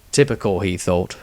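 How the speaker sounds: ordinary delivery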